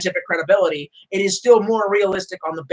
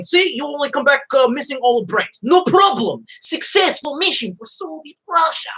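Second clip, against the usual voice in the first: mock Soviet official; 6 semitones higher than usual. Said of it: "launching them into space and going".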